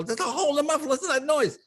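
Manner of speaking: high, indignant complaint; mock outraged father; angry dad. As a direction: demand he listen.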